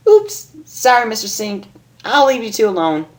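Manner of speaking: high bubbly apology; chirpy mock sorry; Pinkie apology. Pinkie apologizing to the sink, high chirpy voice.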